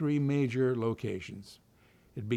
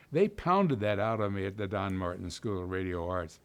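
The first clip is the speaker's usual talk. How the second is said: ordinary talk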